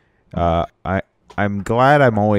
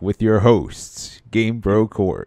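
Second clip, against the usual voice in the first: higher, slow mock announcer intro; voice drop announcer; 4 semitones higher, slower than usual. Co-host pitching a podcast intro with voice drops, announcer voice. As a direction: draw out a show intro like an announcer. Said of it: "like, voice drops".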